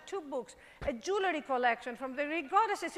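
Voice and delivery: normal talking voice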